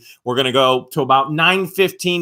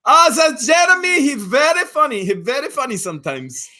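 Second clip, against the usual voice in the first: much higher Indian accent, mom impression; 13 semitones higher, 4 dB louder than usual. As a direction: Indian accent like a doting mother. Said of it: "Do your impersonation".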